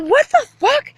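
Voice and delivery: outraged what the fuck. Retold or imitated. Woman retelling her shocked reaction, high outraged outburst. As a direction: blurt it out in disbelief.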